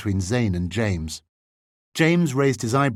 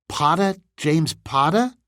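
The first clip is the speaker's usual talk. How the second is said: curious repeat; 7 semitones higher, 3 dB louder than usual. Acted. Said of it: "tilting his head curiously".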